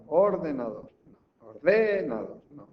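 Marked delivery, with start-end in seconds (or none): 0.1-0.9 "ordenador" with OR punched louder, the rest falling away; stress on first syllable. Shown as a demonstration. Teacher testing for the stressed syllable, "ordenador" stressed on OR. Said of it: "busco la sílaba tónica". 1.5-2.4 "ordenador" with DE loud and drawn out; stress on second syllable. Shown as a demonstration. Teacher testing for the stressed syllable, "ordenador" stressed on DE. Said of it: "busco la sílaba tónica".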